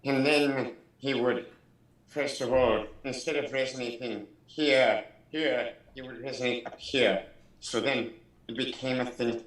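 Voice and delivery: a hint of a resonant orator's voice; Orson Welles. Shown as a demonstration. Showing friends where the voice resonates, Orson Welles impression. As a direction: lightly slip into a radio orator's resonance. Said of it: "This is Orson Welles".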